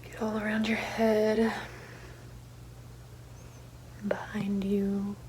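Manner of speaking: hushed, near-whispered cleansing; ASMR style. Real, not acted. Host cleansing all around the viewer's head, ASMR style. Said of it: "A S M R style".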